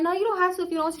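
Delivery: high-pitched, acted politeness; polite demurral. Guest telling friend not to walk her out, high polite.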